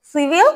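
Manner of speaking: "সিভিল" with a faint, softened l; barely touch the l at the end of "সিভিল"; light final l. Shown as a demonstration. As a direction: model "সিভিল" rising, l almost swallowed. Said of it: "এখানে এলটা একটু হালকা উচ্চারণ হয়".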